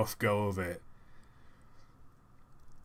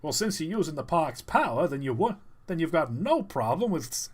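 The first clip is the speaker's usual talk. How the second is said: lightly raised ranger voice; mildly mock park ranger; a hint of a ranger; 6 semitones higher than usual. Meme ranger line.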